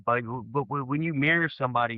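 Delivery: ordinary talk, nothing marked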